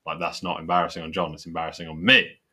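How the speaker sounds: normal talking voice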